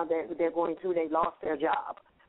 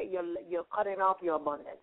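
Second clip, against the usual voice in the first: usual speaking voice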